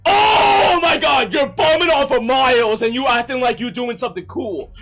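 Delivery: high, loud angry tirade; furious shout. Real, not acted. Streamer raging at Becca for mooching off Miles. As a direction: blow up at her.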